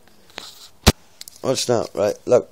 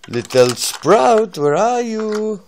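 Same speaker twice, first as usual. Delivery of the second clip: slow sing-song call; 3 semitones higher, much slower than usual. Pretend. Dad calling for lost toy.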